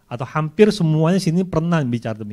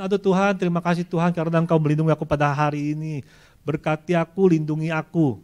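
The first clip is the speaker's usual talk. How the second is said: a mock rushed prayer before sleep, higher; hasty bedtime prayer; 4 semitones higher, faster than usual. Sleepy believer rushing a prayer to God, fast and high. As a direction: rattle off a quick prayer, fast.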